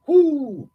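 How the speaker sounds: high, loud mocking whoop; sarcastic high whoop. Real, not acted. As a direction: whoop at them in derision. Mocking cheer at rival's pettiness.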